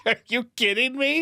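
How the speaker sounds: high-pitched amused outrage; real laughter lifting the voice high; incredulous laughing. Real, not acted. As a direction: protest through a laugh.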